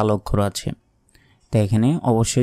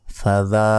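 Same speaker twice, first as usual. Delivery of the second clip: ordinary talk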